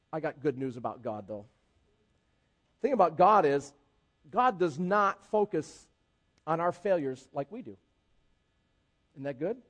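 usual speaking voice

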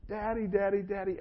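put-on high, sing-song little girl calling Daddy. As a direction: call out like an excited small daughter. Daughter running to dad.

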